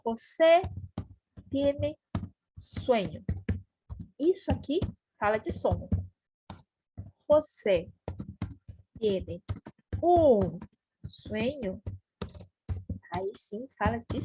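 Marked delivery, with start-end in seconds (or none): 0.0-3.2 "José tiene sueño" word by word, long pauses between; slow dictation. Shown as a demonstration. Teacher writing it out, "José tiene sueño" in separated words. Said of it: "Vamos escrever". 7.3-11.8 slow dictation. Shown as a demonstration. Dictates while writing, stresses un.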